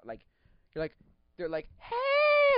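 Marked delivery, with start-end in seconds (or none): 1.8-2.6 much higher, louder bright hey; excited greeting. Retold or imitated. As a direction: call out like spotting an old friend.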